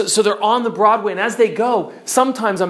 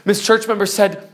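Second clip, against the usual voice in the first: ordinary talk, nothing marked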